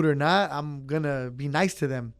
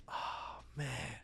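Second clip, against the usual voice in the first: dismayed under-breath; 3 semitones lower, 17 dB quieter than usual. Retold or imitated. Recalling his reaction to the mistake.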